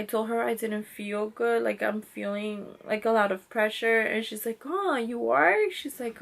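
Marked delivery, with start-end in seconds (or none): 4.7-5.7 drawn-out, higher-pitched mild surprise; slow, surprised retold reaction; surprised nurse. Nurse reacts to her pressure.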